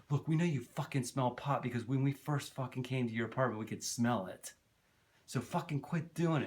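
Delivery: mildly heated imitation of a fed-up friend; mock scolding, pushed up at the end; retold angry outburst. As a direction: raise the pitch and lay into them, half in earnest. Tomas's angry parting shot, retold.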